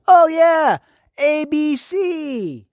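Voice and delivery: high and loud mock surprise, drawn out; hammed-up sarcasm. Vlogger mocking the network to his viewers, exaggerated sarcastic drawl. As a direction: stretch the letters out slowly, dripping sarcasm.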